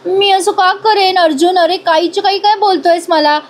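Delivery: a pouting, wounded denial; pouting innocence. Retold or imitated. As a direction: act hurt and innocent with a pout. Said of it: "बारीक तोंड करून म्हणते".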